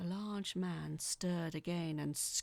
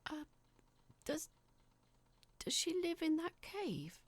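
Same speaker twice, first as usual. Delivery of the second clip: nervous, faltering question, voice jumping higher; shaky; 10 semitones higher than usual. Acted. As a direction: stammer it out, unsure and scared. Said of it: "Mark said shakily".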